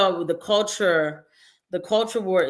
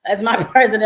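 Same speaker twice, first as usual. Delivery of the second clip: louder, laughing through the words; laughing pride; 3 semitones higher, 8 dB louder than usual. Retold or imitated. Retelling her reaction in the car, louder, laughing with pride. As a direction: beam and laugh it out. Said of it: "I was like".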